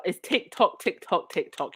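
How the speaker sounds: ordinary talk, nothing marked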